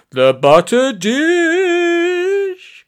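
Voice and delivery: very high, drawn-out cry losing conviction. A narrator's reading. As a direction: keep proclaiming, but with doubt creeping in. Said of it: "sounding a lot less sure of himself".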